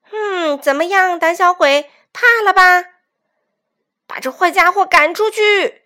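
high, loud, triumphant jeer; taunting shout. A narrator's reading. Young roosters chasing off the weasel, taunting shout. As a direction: crow it at a coward.

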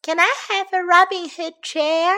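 high, loud pleading cub; begging. A narrator's reading. Baby bear asking parents for a chair, high begging. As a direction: beg with a rising question. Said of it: "begged Baby Bear".

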